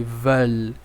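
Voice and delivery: "Veulent" with a clear L sounded at the end; final L sound. Shown as a demonstration. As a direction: land on the L of "Veulent". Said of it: "tem um som de L no final".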